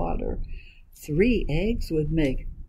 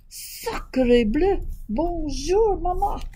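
high French-speaking duckling; baby duck; 10 semitones higher, slower than usual. Acted. Baby duck greets mama in French.

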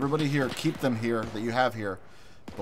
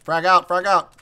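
cover me shout; 4 semitones higher, slower than usual. Acted. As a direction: shout for cover over gunfire. Grenade callout, asking cover.

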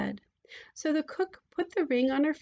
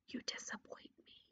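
very soft, close whisper; 19 dB quieter than usual. A narrator's reading. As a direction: whisper it right into the ear. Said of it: "leaned close to him and whispered".

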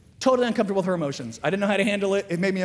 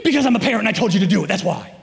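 loud, rapid parental comeback; mock authoritarian father, fast and loud; loud dad voice; 3 semitones higher, 8 dB louder, faster than usual. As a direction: shut the child down forcefully. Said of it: "then you start talking really loud".

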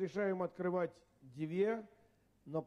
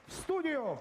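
slow, raised prize announcement; game show announcer; 4 semitones higher, slower than usual. Acted. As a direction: present the prizes like a TV host. Host announces super prizes.

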